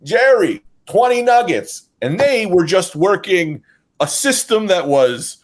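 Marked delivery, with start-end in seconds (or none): 0.0-1.9 higher, drawn-out call to the kitchen; calling out an order. Retold or imitated. Counter man to the cook after taking cash, calling out high.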